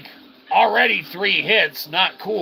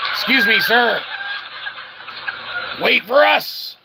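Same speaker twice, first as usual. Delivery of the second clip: higher, playful call after the runaway money; mock-polite calling out; 7 semitones higher than usual. Gamer calling after escaping coins, mock-polite and high. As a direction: politely hail it to wait up.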